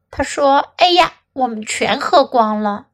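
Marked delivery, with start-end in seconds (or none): none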